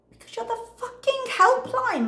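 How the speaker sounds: high-pitched mock outrage; retold indignant outburst; exasperated retort. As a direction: squeal it in comic exasperation. Acts out exasperated retort to helpline.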